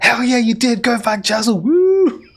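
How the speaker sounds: high, loud whooping cheer; a citizen cheering from the wagon, loud and high; cheering shout. Acted. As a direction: holler it out like an excited fan.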